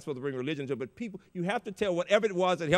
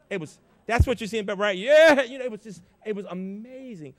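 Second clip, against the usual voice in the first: excited; 6 semitones higher, 11 dB louder than usual. Real, not acted. Crowd on its feet, applause.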